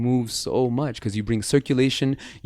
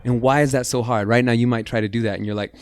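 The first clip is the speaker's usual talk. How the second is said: usual speaking voice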